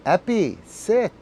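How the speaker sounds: firm command. Real, not acted. Owner firmly ordering the dog to sit.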